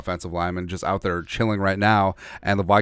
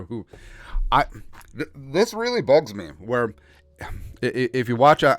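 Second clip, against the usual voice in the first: normal talking voice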